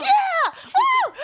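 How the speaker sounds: very high, loud party whoop; whooping cheer. Retold or imitated. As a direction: let out a wild re-enacted cheer. Acts out wild riding whoops.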